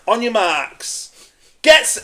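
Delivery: mock starter building up to the off; race starter's call. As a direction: call the runners to the line, stretched out.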